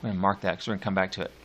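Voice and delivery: ordinary delivery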